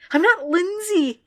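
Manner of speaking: high, slow shrill cry; a high, slow half-shriek. A narrator's reading. Narrator voices Lindsay crying shrilly, high and slow. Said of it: "she cried shrilly".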